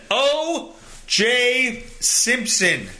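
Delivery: O J Simpson stretched slow and dramatic; slow, announcer-like "O J Simpson"; drawn-out name. Shown as a demonstration. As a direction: savour each syllable of "O J Simpson".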